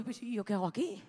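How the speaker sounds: low, soft muttered question; quiet mutter. Retold or imitated. Left alone at the pool, a quiet mutter. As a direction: mutter it to yourself.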